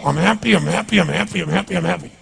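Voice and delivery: rote chant losing energy; empty affirmation. Acted. Coach mocking affirmations said without changing physiology, empty chant. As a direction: repeat it mechanically without conviction. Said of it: "not affirmations. Affirmation, you go".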